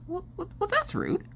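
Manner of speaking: high and soft, half laughing; a touch of mock offense, giggling; giggly mock offense. Real, not acted. Wife repeating her comeback, high giggly voice.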